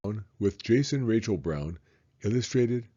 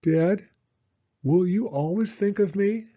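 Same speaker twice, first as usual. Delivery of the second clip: put-on higher, pleading young son; little boy; 11 semitones higher, 4 dB louder than usual. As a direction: ask it as a small boy afraid of being forgotten. Child voice reading son's lines.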